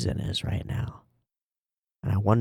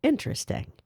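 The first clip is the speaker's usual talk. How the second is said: intrigued; 4 dB quieter than usual. Real, not acted. Musing after theory.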